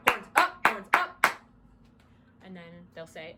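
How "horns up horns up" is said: loud, punchy command, pitched up; commanding call. Shown as a demonstration. Coach demonstrates the second band command, commanding call. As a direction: call it out sharply, hitting UP. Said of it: "four claps and then the command".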